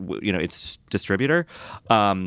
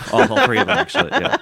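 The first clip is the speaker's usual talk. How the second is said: laughing; 6 dB louder, faster than usual. Real, not acted. Jokes that the films are short.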